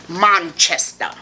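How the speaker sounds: slow, drawn-out British "Manchester", ending "-stah"; British accent. Shown as a demonstration. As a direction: stretch out a put-on British accent. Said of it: "self-conscious about my British accent".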